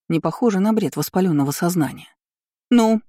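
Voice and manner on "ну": a sharp, higher, louder bark. A narrator's reading. Heroine barking at the writhing count. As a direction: snap it out to make him move. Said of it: "рявкнула на корчащегося графа".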